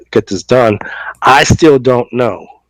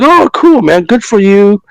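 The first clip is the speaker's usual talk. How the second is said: much higher, louder gushing congratulations; imitating excitement; 9 semitones higher, 3 dB louder than usual. Congratulating the retiring friend, high and loud with mock excitement. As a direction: overdo the happy-for-you cheer.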